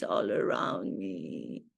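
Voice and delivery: dropped far down into a deep, low voice. Shown as a demonstration. A deep dramatic repeat of the silence wish. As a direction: sink the line way low like an echo. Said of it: "I want silence all around me".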